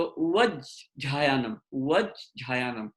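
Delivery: उवझायानम slowly, ज then aspirated झ; slow model pronunciation. Shown as a demonstration. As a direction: stretch उवझायानम so the ज and झ are both heard. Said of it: "पहले ज है और फिर झ है". (धीरे से सही उच्चारण उवझायानम, पहले ज फिर झ)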